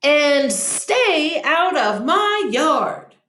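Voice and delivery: grumpy cactus still yelling. Acted. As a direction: drag out the order, annoyed. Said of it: "Hank yelled back".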